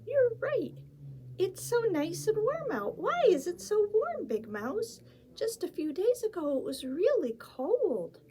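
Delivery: high squeaky little mouse; high, bright little mouse voice. Acted. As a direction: play the tiny excited mouse.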